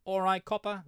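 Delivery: high-pitched London urchin accent; cheeky London urchin greeting a policeman; London street urchin accent. Shown as a demonstration. Demonstrating an old poem's character, London street urchin accent. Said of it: "it was in this fucking accent".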